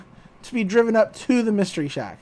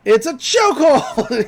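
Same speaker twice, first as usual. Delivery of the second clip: slow and louder, laughing through it; laughing gruff line; 4 semitones higher, 5 dB louder than usual. Retold or imitated. Stan grabbing Dipper, laughing through a mock growl. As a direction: growl the punchline while cracking up. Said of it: "He's like".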